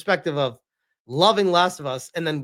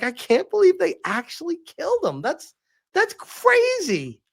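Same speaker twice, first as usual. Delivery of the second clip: high, slowing wife voice; imitating wife's disbelief; 11 semitones higher than usual. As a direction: drag out the wife's crazy in disbelief.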